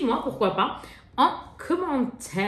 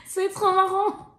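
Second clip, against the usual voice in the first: laughing; 9 semitones higher, slower than usual. Real, not acted. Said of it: "Je suis une grosse gamine".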